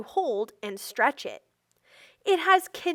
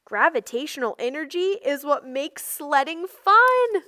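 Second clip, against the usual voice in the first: ordinary talk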